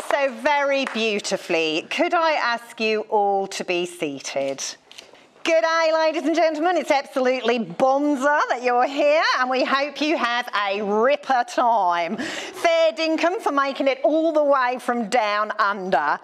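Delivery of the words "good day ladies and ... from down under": put-on broad Aussie accent, faster, higher; queensland accent. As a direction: ham up a broad Aussie greeting. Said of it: "Yeah, don't worry, I will stop now".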